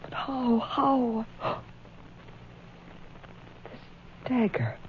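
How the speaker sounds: softly, stunned by the body; barely voiced, in shock; hushed and shaken. Woman alone with a corpse, hushed and shaken.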